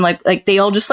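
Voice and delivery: ordinary talk, nothing marked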